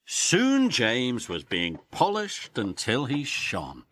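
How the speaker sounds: plain reading voice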